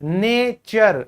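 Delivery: नेचर stretched very slowly, nay-cher; very slow teacher demo of नेचर; very slow model word. Shown as a demonstration. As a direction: draw out नेचर as nay-cher for students to copy. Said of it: "इसी तरीके से नेचर".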